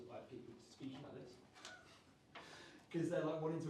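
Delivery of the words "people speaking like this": salsa mumble. Shown as a demonstration. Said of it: "You see salsa".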